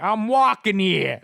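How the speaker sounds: bad New York accent, slow; hammy New York "walking here". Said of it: "did a bad, uh, New York accent".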